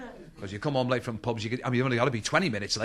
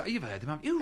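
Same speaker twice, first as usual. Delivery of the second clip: ordinary delivery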